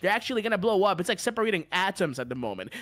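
fast, breakneck merch pitch; rushed sales patter. Pretend. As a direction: race through the offer without pausing.

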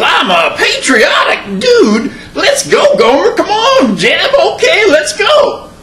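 put-on a goofy Tennessee country boy, squeaky and laughing; Tennessee hillbilly accent.

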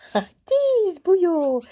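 slow, high, loud playful telling-off; mock scolding. Real, not acted. Owner mock-scolding a naughty puppy, slow and loud. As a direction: stretch it out in mock outrage.

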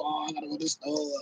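a quick soft sneer at the store runners; mock whiny shopper. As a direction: brush it off as their silly excuse. Mocking others who must shop.

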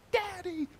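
imitating his little daughter calling out Daddy; toddler's yell. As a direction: squeal it like a little girl running in. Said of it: "She sees me again".

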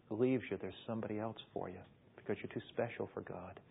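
soft, low and gentle; tender reassurance. Acted. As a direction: hold her close with your voice. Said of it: "you talk to her gently and tenderly".

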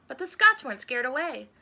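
ordinary delivery, nothing marked

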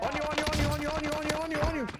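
high, rapid-fire repeated warning; urgent callout. Real, not acted. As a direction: alert your teammate over and over. Urgent warning to teammate in game.

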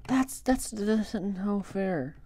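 high, soft, slow whine; whiny complaint. Real, not acted. Gamer complaining about an unfair loss, whining. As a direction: protest weakly in a small high voice. Complains after losing in the game.

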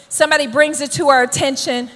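usual speaking voice

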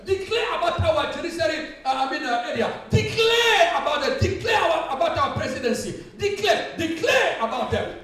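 full-force preaching. Real, not acted. Preacher urges declarations over army and police, forceful preaching. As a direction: hammer out each repeated command.

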